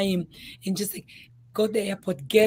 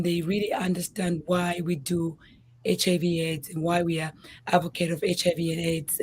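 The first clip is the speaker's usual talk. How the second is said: usual speaking voice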